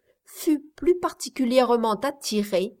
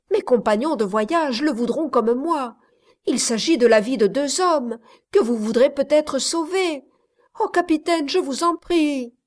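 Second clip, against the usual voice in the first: ardent plea; 5 semitones higher, 4 dB louder than usual. A narrator's reading. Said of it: "d'une voix ardente".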